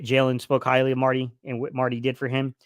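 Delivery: normal talking voice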